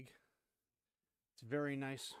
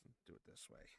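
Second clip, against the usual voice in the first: whispering; 7 semitones lower, 19 dB quieter than usual. Real, not acted. Muttering to himself while working.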